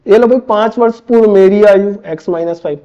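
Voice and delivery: ordinary talk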